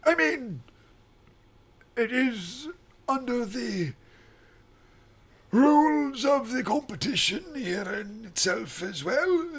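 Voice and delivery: put-on high, frail old man voice; quavering elderly temple master.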